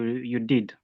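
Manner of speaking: ordinary delivery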